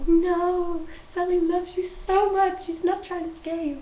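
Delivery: put-on high, loud, desperate plea to a scared child; pleading with Boo. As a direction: cry out to reassure the frightened girl.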